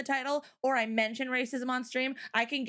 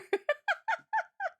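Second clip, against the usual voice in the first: high giggling laughter; giggle. Real, not acted. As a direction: giggle away in a high run. Laughing at own joke.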